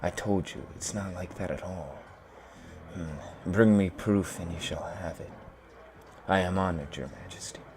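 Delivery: quiet mutter to himself, voice drawn back; troll muttering. A narrator's reading. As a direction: mumble as if talking to unseen spirits.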